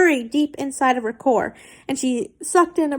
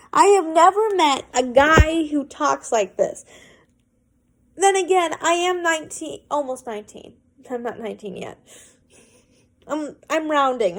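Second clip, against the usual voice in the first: plain reading voice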